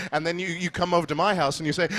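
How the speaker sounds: normal talking voice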